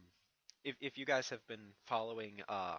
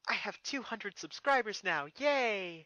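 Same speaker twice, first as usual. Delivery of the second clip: higher, louder happy news; excited announcement; 9 semitones higher, 7 dB louder than usual. Real, not acted. Youtuber cheering his subscriber count, excited. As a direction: announce it with glee.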